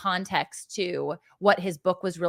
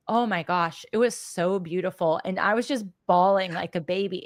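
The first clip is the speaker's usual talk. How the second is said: ordinary talk, nothing marked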